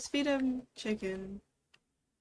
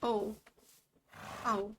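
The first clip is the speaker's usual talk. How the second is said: normal talking voice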